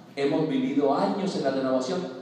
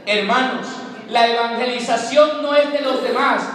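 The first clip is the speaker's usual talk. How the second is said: louder, higher preaching climax; predicación con fuerza; 5 semitones higher, 5 dB louder than usual. Real, not acted. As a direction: drive it home to the congregation. (predicador exhortando a los hermanos con fuerza)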